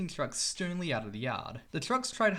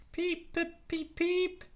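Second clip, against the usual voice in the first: much higher piping engine whistle; whistle peeps; 13 semitones higher, much slower than usual. Acted. Edward whistling to James, high piping peeps. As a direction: pipe it like a little whistle.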